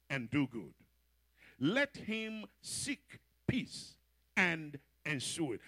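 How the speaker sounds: slow, deliberate reading of verse; scripture reading. Acted. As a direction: read each phrase weighty and slow. Reads scripture slowly.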